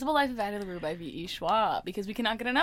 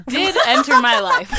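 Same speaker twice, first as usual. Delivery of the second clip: laugh-crying, much louder; louder, slow, laughing hard; 4 semitones higher, 10 dB louder, slower than usual. Real, not acted. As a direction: push the words out through laughter. Laughing about covid story.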